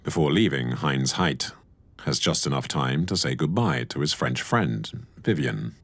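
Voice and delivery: even, measured voice-over read; documentary narration. A narrator's reading. Narrator introducing the Allied landings, measured documentary read. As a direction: narrate the history calmly.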